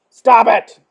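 loud, firm command; firm scolding. Acted. Reader scolding clowns who laugh, loud and firm.

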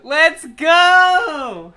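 high, loud cheer; celebratory shout. Real, not acted. Streamer celebrating Steve's reveal, celebratory shout. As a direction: yell it out in real triumph.

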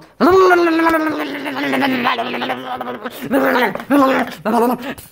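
long, high warbling babble; silly babbling noise. Pretend. Leads the plushies in after the countdown, silly babble. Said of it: "everyone just goes like".

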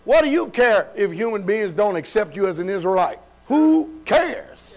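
forceful preaching. Real, not acted. Preacher challenges congregation on who cares, forceful preaching. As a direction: preach it out with punchy emphasis.

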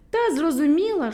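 ordinary delivery, nothing marked

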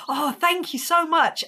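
high, slow, drawn-out thanks; gushing thanks. Retold or imitated. Fan thanks her idol for praise, gushing. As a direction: imitate a smitten fan being complimented.

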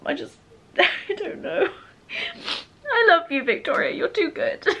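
tearful, half-laughing thanks; laugh-cry your gratitude; laughing through tears. Real, not acted. Thanking her friend for the flowers, laughing through tears.

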